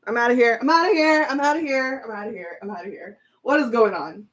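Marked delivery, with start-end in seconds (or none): 0.1-2.0 louder and higher, excited disbelief; disbelieving outburst. Real, not acted. To her viewers after the cards all match, excited disbelief. 2.0-3.2 much softer, trailing off stunned; fading disbelief. Real, not acted. To herself after the shocking spread, fading disbelief.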